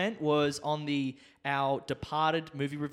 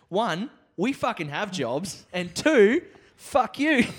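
building to a loud shout; rising angry retort ending in a yell; 5 semitones higher, 6 dB louder, much slower than usual. Acted. Angrily answering the troll.

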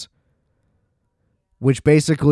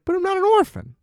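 put-on much higher, pleading child's voice stretching "orphan"; high whiny kid voice insisting "But I'm not an orphan"; faster than usual. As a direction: repeat it like a desperate little girl. Child protests again.

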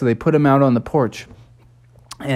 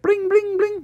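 phone ring. Retold or imitated. Phone ringing at police.

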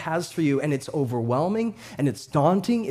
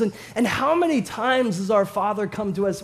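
ordinary delivery

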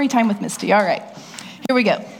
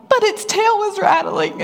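mock panic; 13 semitones higher than usual. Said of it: "And I'm like".

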